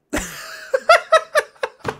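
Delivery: rising shrieking giggle; high squeal laugh. Real, not acted.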